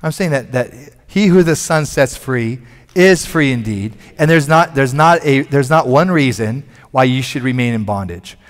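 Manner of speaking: normal talking voice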